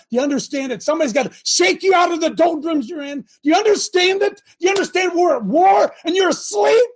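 a preacher yelling out of love; real preaching yell. Real, not acted. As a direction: shake them out of their sleep. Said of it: "I'm yelling at you".

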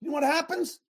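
usual speaking voice

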